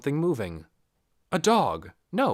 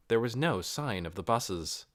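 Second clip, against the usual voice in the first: ordinary delivery, nothing marked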